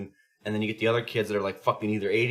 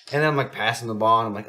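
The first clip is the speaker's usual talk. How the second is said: ordinary delivery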